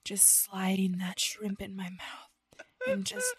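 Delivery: very slow sensual whisper; ASMR whisper. Pretend. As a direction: whisper it suggestively. Parody ASMR.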